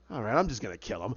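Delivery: low, offhand gangster; casual threat. Retold or imitated. Crime boss deciding on a killing, offhand. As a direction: drop it low, as if bored. Penguin decides to kill him.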